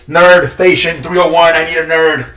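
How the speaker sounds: loud, high, cartoonish man-child cry; Pee Wee Herman voice. Host calling for a nerd, loud high Pee Wee-style cry. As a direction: yell for help like a goofy kid-show host. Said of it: "Pee Wee Herman style".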